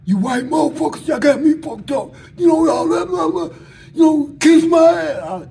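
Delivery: high, loud mock holler; hollered defiance. Inmate looks at the guards and hollers back defiantly. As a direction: imitate an inmate cussing out the guards. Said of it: "looked at the guards and hollered".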